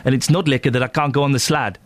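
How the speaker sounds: jokey South African accent. Shown as a demonstration. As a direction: explain the slang in put-on South African. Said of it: "I'm doing a South African".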